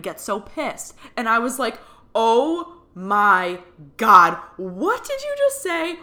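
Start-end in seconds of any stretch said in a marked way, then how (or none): 2.1-6.0 loud, drawn-out opening, then a high-pitched demand; stunned, stretch it out, then shoot up high; retold astonishment, pitched way up; shocked disbelief. Acts out shocked reaction to medium.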